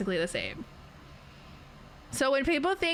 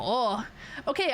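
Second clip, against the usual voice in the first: ordinary delivery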